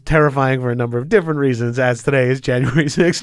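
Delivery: usual speaking voice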